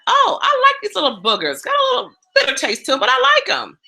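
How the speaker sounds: high pleasantly surprised voice; delighted surprise. Retold or imitated. Retelling first taste of sprouts, high delighted surprise. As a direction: discover you like them after all. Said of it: "I was like".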